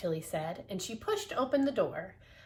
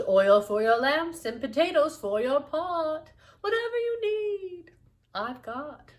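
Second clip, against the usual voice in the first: higher, louder sing-song shopkeeper; shopkeeper Missus Wise; 4 semitones higher, 7 dB louder than usual. Acted. Missus Wise listing her goods to customers, high sing-song. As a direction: sell your wares like a jolly shopkeeper. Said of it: "Missus Wise".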